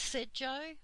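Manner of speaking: ordinary delivery, nothing marked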